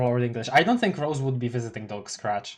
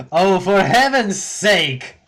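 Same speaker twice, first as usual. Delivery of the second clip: loud, slow, higher exasperated cry; exasperated exclaim; 5 semitones higher, 7 dB louder, slower than usual. Acted. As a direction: throw up your hands in mock despair. Character exasperated outburst.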